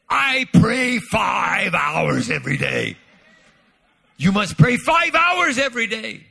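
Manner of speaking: put-on slow, loud Korean-accented proclamation; mock Korean accent. Korean pastor telling pastors to pray five hours, a loud mock Korean accent. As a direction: press every word in a Korean preacher's accent. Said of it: "I don't have a good Ch-, uh, Korean accent".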